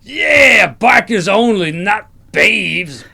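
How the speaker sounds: loud, high, drawn-out jeer; biker. Acted. Second biker backing his mate against newcomers, loud jeering. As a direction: back up your biker mate loudly. Reading biker script in character.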